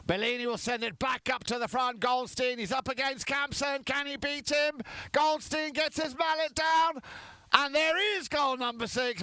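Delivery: rising excitement. Real, not acted. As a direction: climb in pitch as the attack closes in, then burst on the goal. Goal building in play-by-play.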